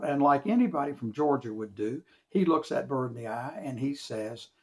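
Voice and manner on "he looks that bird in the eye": ordinary delivery, nothing marked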